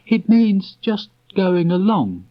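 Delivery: high, slow and gentle; gentle explaining. Acted. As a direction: Christopher Robin explaining doing nothing. Christopher Robin explaining nothing.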